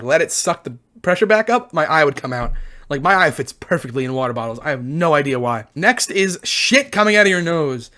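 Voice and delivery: ordinary talk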